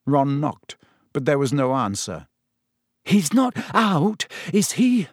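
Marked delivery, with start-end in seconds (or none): 3.0-5.1 put-on high, slow, uneasy girl's voice; shivering worry. A narrator's reading. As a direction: ask it while shivering in the cold. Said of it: "who was shivering under her cloak".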